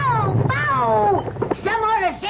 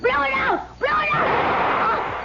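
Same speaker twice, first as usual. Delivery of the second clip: urgent plea; 5 semitones higher, 3 dB quieter, slower than usual. Acted. Urgent plea to kill the light.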